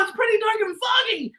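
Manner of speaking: excited spooky. Shown as a demonstration. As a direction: build the spooky excitement for the child.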